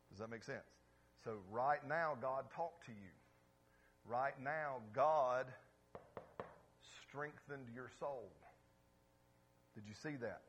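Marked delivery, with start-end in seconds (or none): 4.9-5.5 God shouted out, much louder. Real, not acted. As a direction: hit "God" hard with a burst of volume. Preacher building to a climax.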